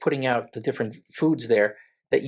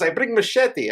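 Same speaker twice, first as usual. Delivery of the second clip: high-pitched mock Italian accent; bad Italian accent; 10 semitones higher, slower than usual. Man quoting the veal guy's plan, bad Italian accent. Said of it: "This is my bad Italian accent".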